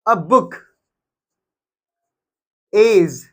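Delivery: slow dictation with long pauses between words; spaced out, as if writing along. Shown as a demonstration. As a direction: dictate it one word at a time. Dictating example while writing it out.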